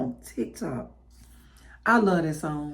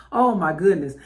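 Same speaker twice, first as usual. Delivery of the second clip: ordinary delivery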